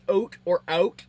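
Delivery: "oat or out" with a rounded Canadian vowel; own Canadian vowel. Shown as a demonstration. Streamer showing how she says it, "out" leaning towards "oat". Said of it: "And I say more".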